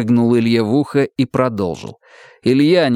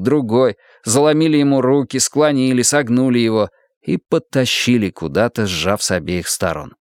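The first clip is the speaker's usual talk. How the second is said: plain reading voice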